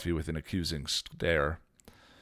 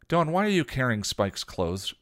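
demanding; 8 semitones higher, 6 dB louder than usual. A narrator's reading. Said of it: "Buffy demanded".

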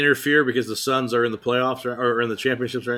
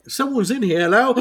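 calling out; 9 semitones higher than usual. Real, not acted. Calling out to someone arriving.

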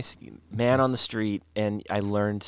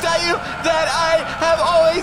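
high, loud desperate confession; panicked shouting; 10 dB louder than usual. Acted. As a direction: yell it out like you're about to die. Last confession before crash.

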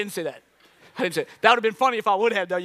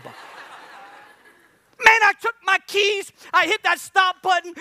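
high, comic storming-off energy; indignant outburst; 7 semitones higher, 3 dB louder, much slower than usual. Retold or imitated. Comedian recounts quitting the treadmill, high mock indignation. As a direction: burst out high in mock outrage.

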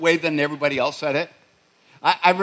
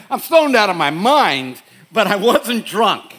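mock defense; 5 semitones higher, 6 dB louder than usual. Son's technicality defense to mom.

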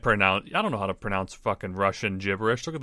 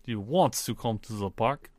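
Russian accent with oddly placed rises; Russian inflection; 4 dB quieter than usual. Shown as a demonstration. Co-host having another try, Russian accent. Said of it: "inflection in random ass parts".

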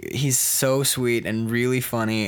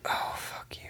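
hushed, whispered playful disbelief; mock-wounded whisper; 11 dB quieter than usual. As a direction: whisper it as if appalled by your partner. Mock hurt at partner's ignorant question.

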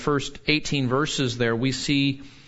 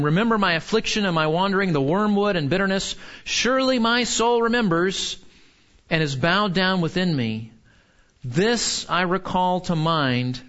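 higher, urgent reading that slows toward hope; impassioned scripture reading; 5 semitones higher than usual. Acted. Preacher reading the turn to hope aloud, higher and urgent, then slowing. Reads verses nineteen and following.

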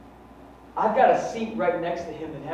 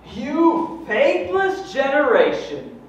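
high, loud, slow rebuke; Jesus rebuking; 10 semitones higher, 9 dB louder, much slower than usual. Acted. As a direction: thunder it out as a stern Jesus. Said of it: "And Jesus goes".

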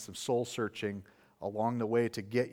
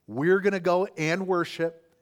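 higher and louder, ringing with faith; bold declaration; 6 semitones higher, 7 dB louder than usual. Acted. Abraham pledging to the young men: bold, ringing declaration. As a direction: proclaim it like a confident promise.

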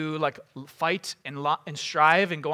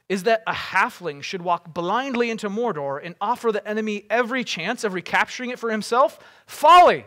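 heated argument, pitched up, bursting into a shout; Boromir's indignant cry; 4 semitones higher, 6 dB louder than usual. Acted. Warrior scorning the halfling's mission, indignant and building to a shout. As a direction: argue hotly, then explode on the last word. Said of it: "he cried".

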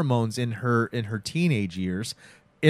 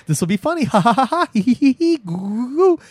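high, loud, silly cackling; Evil Ed's gleeful cackle; 12 semitones higher, 6 dB louder than usual. Acted. Prankster in a spooky alleyway, gleeful.

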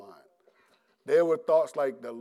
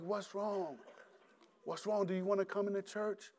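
higher and softer, kindly concern; welcoming voice; 5 semitones higher, 10 dB quieter than usual. Retold or imitated. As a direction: invite him in gently. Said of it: "she had a very welcoming voice".

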